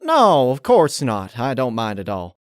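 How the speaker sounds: high, loud, strained fake brightness that settles; a lie covered with a bright smile; forced cheer. A narrator's reading. Applejack insists she doesn't mind, forced cheer. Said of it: "obviously forced smile as she said".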